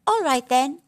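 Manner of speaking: a lightly voiced brave mouse; Grass Mouse.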